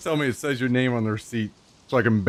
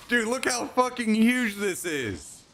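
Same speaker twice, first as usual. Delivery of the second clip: amazed disbelief; 8 semitones higher, 3 dB quieter than usual. Real, not acted. Amazed by huge food.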